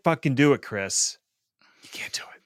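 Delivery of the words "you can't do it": breathless frustrated whisper. Acted. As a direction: hiss it out in defeat. Frustrated rant about city building.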